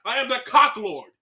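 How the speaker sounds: hammed-up grand proclamation. Continues the mock proclamation.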